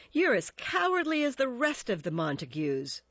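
jeering. A narrator's reading. Tybalt insults Romeo and his family, jeering. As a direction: jeer at him as a coward. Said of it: "jeered Tybalt".

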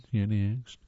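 usual speaking voice

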